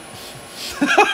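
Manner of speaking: high, short burst of laughter; real laugh. Real, not acted. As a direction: chuckle at your own verdict. Joking about the control's unfriendliness.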